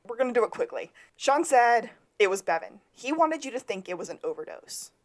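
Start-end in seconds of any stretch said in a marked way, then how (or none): none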